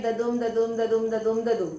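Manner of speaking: ददुम ददुम chanted, weak then strong beat each time; iambic da-DUM beat. Shown as a demonstration. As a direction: tap out ददुम ददुम like a metronome, stress on दुम. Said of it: "unstress और stress यानी कि".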